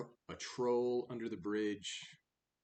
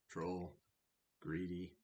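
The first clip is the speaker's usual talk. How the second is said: ordinary delivery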